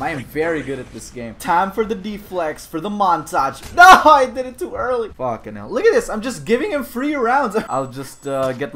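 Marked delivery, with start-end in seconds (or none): 3.7-5.1 loud, high cry of dismay; a real gamer's "no!"; frustrated shout. Real, not acted. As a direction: shout out the blown timing. Mistimes a move in game.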